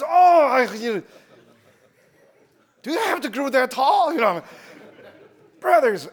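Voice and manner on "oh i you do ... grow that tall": mock astonished. Hugged by tall brothers.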